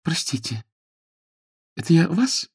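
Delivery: put-on high young lady's voice, tentative; apologetic Jane. Jane realises she kicked Frederick, apologetic. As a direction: ask sheepishly.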